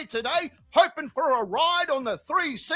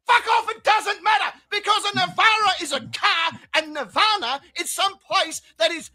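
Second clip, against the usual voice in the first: louder, higher, snapping back in irritation; angry outburst; 5 semitones higher, 6 dB louder than usual. Pretend. Snapping at the zen gardeners over Nirvana, loud and irritated. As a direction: bark it back at them. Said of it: "And you'll be like".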